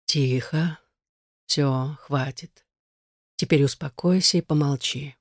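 gentle hushing; soft, insinuating voice. A narrator's reading. Unseen stranger calms delirious Igar, soft insinuating voice. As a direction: hush him softly and smoothly. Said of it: "Мягкий и вкрадчивый".

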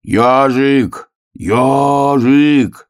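высокий протяжный зов; зови друга, растягивая имя; крик медвежонка. A narrator's reading. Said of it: "закричал он". (медвежонок громко зовёт)

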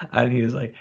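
ordinary talk, nothing marked

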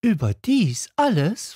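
can it really be all that; little prince asking in wonder; amazed little prince. A narrator's reading. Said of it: "fragte der kleine Prinz".

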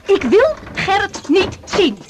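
high, slow, firm refusal; angry refusal. Acted. Woman refuses her ex on the doorstep, angry and slow. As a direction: dig in and refuse him outright.

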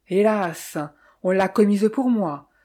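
ordinary delivery, nothing marked

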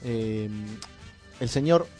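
usual speaking voice

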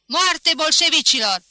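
high, very loud war cry; roared slogan. A narrator's reading. Professor ignoring laughter, bellowing to the crowd, roared slogan. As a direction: bellow it across the square. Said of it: "răcni din nou".

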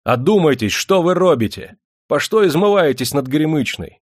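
high, loud reproach; indignant rebuke. Acted. Perstень shames the crowd, loud and high. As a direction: shame them loudly.

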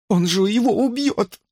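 high, frightened cry; alarmed woman's voice; panicked plea. A narrator's reading. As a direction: plead in terror. Brook pleading in panic.

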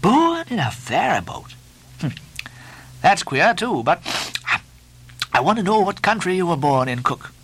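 high, surprised questioning; Stubb, puzzled mate. A narrator's reading.

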